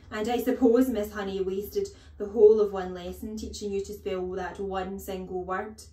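loud, imperious headmistress voice; scornful headmistress. Acted. Headmistress doubting the teacher's lessons, loudly scornful. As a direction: lay on the scorn, starting loud.

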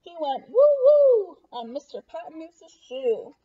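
loud, slow, playful reveal; comic excitement. Acted. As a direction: make the kids laugh at the shoe. Comic climax read aloud.

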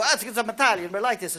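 a colleague's hammed-up Italian accent. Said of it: "You don't have to speak in an Italian accent".

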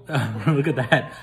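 ordinary talk, nothing marked

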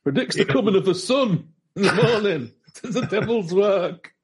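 put-on high, slow, laughing colonial accent; mock horror at sunrise, old-timey accent; New Jersey pre-colonial accent. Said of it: "It's a New Jersey pre-colonial accent".

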